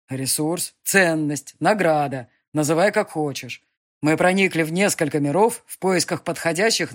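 loud, commanding demon lord. Acted. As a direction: press each word on her. Demon speech.

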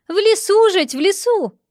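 put-on high, loud old granny; old woman voice. A narrator's reading. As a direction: insist like a shrill village granny.

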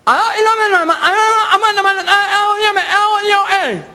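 high, frantic garbled voice; garbled caller. Acted. Caller reporting to a man who understands nothing, garbled. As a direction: babble urgently in a high voice.

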